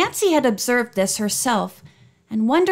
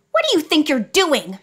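indignant; 6 semitones higher than usual. A narrator's reading. Friend defends Nancy, eyes flashing.

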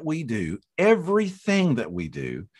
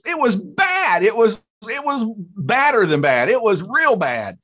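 high, loud comic outrage; storyteller's exaggerated, high-pitched dismay; emphatic disgust; 8 semitones higher, 5 dB louder than usual. Real, not acted. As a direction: hammer home how awful it was. Telling how awful pie tasted.

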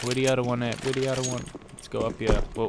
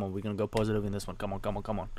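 muttered urging; 2 semitones lower, 7 dB quieter, faster than usual. Real, not acted. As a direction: egg yourself on quietly. Urging quietly under breath.

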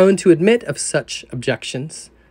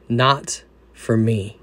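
low, slow and soft; calm assurance; 5 semitones lower, 3 dB quieter, slower than usual. Retold or imitated. Jesus calmly reassuring the burdened sinner, slow and soft. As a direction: say it slowly and gently, no doubt in it.

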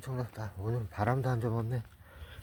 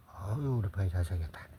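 ordinary talk, nothing marked

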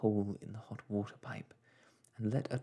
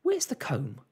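high, louder motherly panic; flustered; 6 dB louder than usual. Acted. Mother tidying before father arrives, flustered. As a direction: fuss in a sudden hurry.

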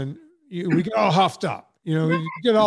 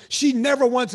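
higher and louder mock protest; indignant denial; 7 semitones higher, 5 dB louder than usual. Friend flatly denying the vice president said it, mock indignant and louder. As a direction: play the friend insisting hotly. Retold quarrel with left-wing friend.

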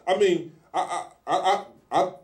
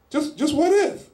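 wondering centurion; 11 semitones higher than usual. Acted. Centurion wondering aloud, acted quote.

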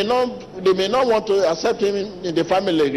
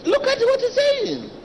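much higher, strained imitation of his plea; imitating the weeping man. Pastor voicing the weeping man to his congregation, high and pleading. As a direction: voice him high and pleading, as if near tears. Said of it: "He said".

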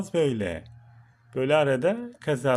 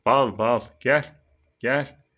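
drawn-out call; 8 dB louder, much slower than usual. Real, not acted. Calling the turtle.

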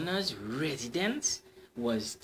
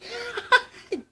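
high giddy laugh; a lovestruck high laugh; high delighted laugh; 5 dB louder than usual. Acted. Cyclist delighted.

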